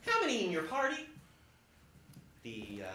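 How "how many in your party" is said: chirpy elf greeter. Retold or imitated. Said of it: "an elf with a palm-sized counter says".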